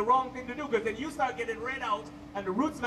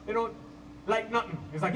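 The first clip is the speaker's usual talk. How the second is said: usual speaking voice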